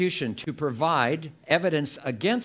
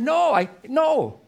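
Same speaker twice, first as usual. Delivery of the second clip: retold protest; 9 semitones higher, 6 dB louder than usual. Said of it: "And I said".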